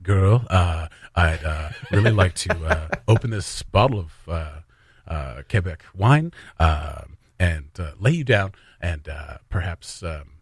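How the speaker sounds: very low, halting seduction full of uhs; mock R&B romantic interlude by a hesitant politician; low, soft pillow talk that keeps stalling; Trudeau impression. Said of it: "But it would be Trudeau".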